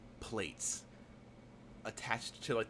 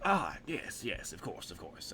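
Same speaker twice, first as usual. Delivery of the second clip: agreeable shopkeeper; 2 semitones lower, slower than usual. Acted. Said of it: "He's like".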